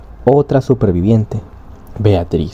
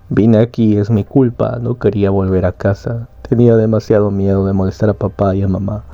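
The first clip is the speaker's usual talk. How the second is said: plain reading voice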